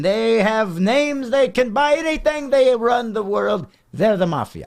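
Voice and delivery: hammed-up, high-pitched Italian accent; mock Italian accent, pitched well up. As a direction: play it as a broad, excitable mock Italian. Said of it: "in an Italian accent".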